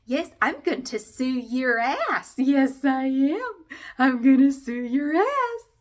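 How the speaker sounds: high, slow, sugary threat; singsong, over-sweet housewife voice; happy Stepford wife. Acted. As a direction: trill it with fake wifely cheer. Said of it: "my happy Stepford wife voice".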